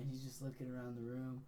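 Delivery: ordinary talk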